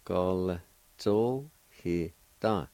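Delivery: "Gol zo he da" drawn out very slowly, syllables spaced; slow careful model. Shown as a demonstration. Teacher modelling the word for learners, very slowly. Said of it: "like this".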